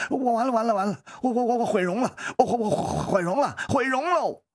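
high, stammering hysterical wail; panicked cry. A narrator's reading. Man wails that his face is ruined, panicked stammering cry. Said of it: "张力叫起来了".

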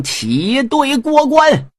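a general's call to march; loud command. A narrator's reading. Commander ordering his troops through the pass, shouting. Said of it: "黄飞虎高喊一声".

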